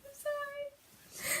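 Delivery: tiny, high, slow and soft; sheepish apology. Retold or imitated. Daughter apologising after dad says shut up, sheepish tiny voice. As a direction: squeak it out very quietly.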